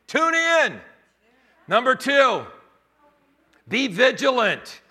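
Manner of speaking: loud, high, driving sermon climax; full-force preaching. Acted. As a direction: preach it out with everything.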